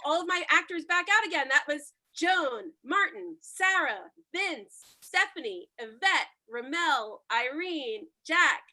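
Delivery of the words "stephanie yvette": ordinary delivery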